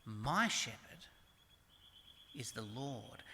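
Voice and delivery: MY drawn out, then a soft drop on "is the Lord"; stress on MY. Shown as a demonstration. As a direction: lean slowly on "My", pause, then quietly finish. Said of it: "that's the emphasis in verse one".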